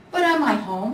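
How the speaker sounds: eager cub voice; hopeful cub. Acted. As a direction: cub hoping for a home. Little bear voice.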